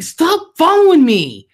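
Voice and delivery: high, drawn-out shout; mock outraged yell. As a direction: play-act yelling at a stalker for all to hear. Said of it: "and I'm like".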